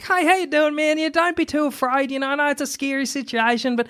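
reassuring surgeon. Acted. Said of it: "But if he comes in, he's like".

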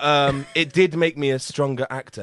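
ordinary talk

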